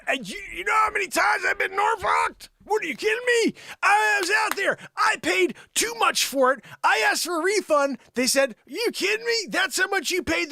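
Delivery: high-pitched outraged yelling; indignant complaint. Acted. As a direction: rant like a cheated customer.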